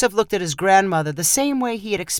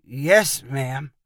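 meek, falling reply; subdued, sheepish obedience; 4 semitones lower than usual. A narrator's reading. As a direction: answer like a scolded boy. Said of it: "Perry sheepishly replied".

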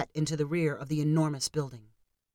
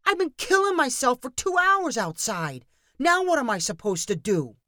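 high, loud exasperated tirade; angry rant; 7 semitones higher, 3 dB louder than usual. A narrator's reading. Danny ranting at Marcus after freezing outside, exasperated. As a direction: vent all the frustration.

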